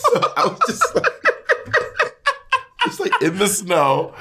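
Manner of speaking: high laughter breaking up the words; laughing hard. Real, not acted. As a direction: try to talk through a laughing fit. Laughing at own fall story.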